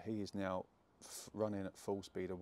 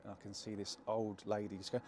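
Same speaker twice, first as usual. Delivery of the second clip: ordinary talk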